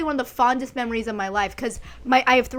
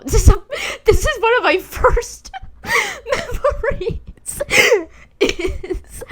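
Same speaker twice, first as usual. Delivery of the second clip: high, loud laughter through the words; laughing hard; 13 semitones higher, 5 dB louder than usual. Real, not acted. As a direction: break into laughter mid-sentence. Laughing at a childhood memory.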